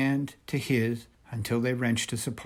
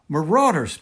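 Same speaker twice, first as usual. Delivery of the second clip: a sudden, louder and higher alarmed warning; alarmed cry; 6 semitones higher, 10 dB louder than usual. A narrator's reading. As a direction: sound the alarm as danger approaches. Alarm at screams in the woods.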